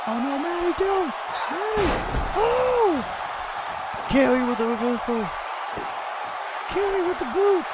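hammed-up panic calling for a ref; frantic commentator. Commentator screaming for a referee during the brawl.

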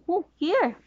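ordinary delivery

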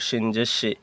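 usual speaking voice

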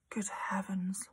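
soft unvoiced gasp of surprise; hushed. Lavender hushed in shock at Hortensia's tale. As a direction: breathe it out in shock. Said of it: "Lavender said".

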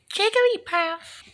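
very high, slow creature chirp; Jigglypuff. Acted. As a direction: squeak the name like a tiny pet. Creature says its name.

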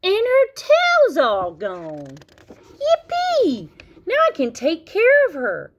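high, loud celebrating youngster; overjoyed storybook cheer; excited cry. Acted. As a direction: squeal with delight, pitch soaring. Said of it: "cried Froggy".

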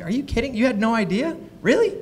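can't believe he didn't know; incredulous. Retold or imitated. Wife teasing husband over salon prices, incredulous.

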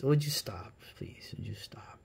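a breathless whisper asking someone to stop; whispered plea. Real, not acted. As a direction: whisper it urgently to someone off-mic. Hushing someone mid-video.

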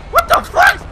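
loud, high-pitched outraged disbelief; startled yell. Real, not acted. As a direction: cry out in shock. Startled outburst during street fight.

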